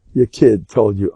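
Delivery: ordinary talk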